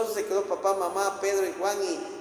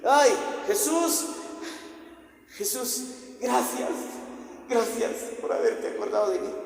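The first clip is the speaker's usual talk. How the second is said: high, emotional acted thanks; acted overwhelmed gratitude; 6 semitones higher than usual. Lázaro revived, thanking Jesus, high acted relief. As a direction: gasp out thanks as one just rescued.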